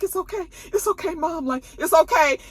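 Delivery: high-pitched imitation of a distressed son; retold pleading comfort. Son tells his bleeding mom, high retold pleading.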